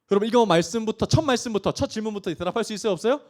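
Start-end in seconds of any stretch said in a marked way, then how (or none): none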